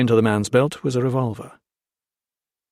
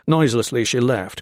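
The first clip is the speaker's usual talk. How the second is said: usual speaking voice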